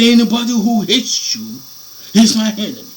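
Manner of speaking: loud, solemn proclamation of the divine word; the preacher voices God promising to punish his enemies, loud and solemn; voicing God. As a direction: declare it as God's own vow. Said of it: "he said".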